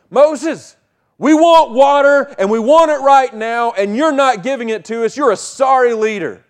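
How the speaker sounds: impatient crowd nagging Moses; whining Israelites. Retold or imitated. Israelites complaining to Moses, mock whiny demand. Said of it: "And they are saying".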